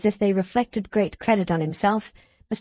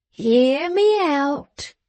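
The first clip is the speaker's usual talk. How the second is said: slow insistent Wegg; 6 semitones higher, 3 dB louder, much slower than usual. A narrator's reading.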